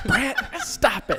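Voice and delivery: high, slow mom voice with laughter; drawn-out high mock telling-off; imitated scolding mom. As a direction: scold like an exasperated mother, pitched high. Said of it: "my mom's like".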